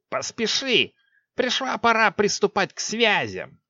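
high urgent witch voice; sorceress voice. A narrator's reading. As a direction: hurry her like a sorceress. Six voiced in story.

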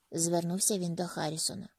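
ordinary delivery

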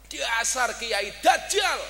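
fierce insult. Retold or imitated. Preacher quoting his fiercest online critic, fierce insult. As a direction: hurl the retold insult slowly and loudly. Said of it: "sing paling galak napa".

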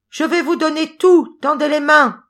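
high, loud piping shout. A narrator's reading. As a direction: yell it high at the top of your head. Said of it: "se mit à crier encore".

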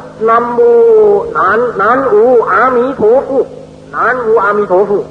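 "อามิโทพุ" chanted as Mandarin o-mi-to-fu; Mandarin-style chant, "อามิโทพุ" ending in fu; Mandarin pronunciation. Shown as a demonstration. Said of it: "ถ้าว่าเป็นจีนหลวงก็ว่า".

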